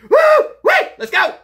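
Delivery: very high, loud cheering yells; hyped whoop. Real, not acted. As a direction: get amped for the drink. Hyping before drinking.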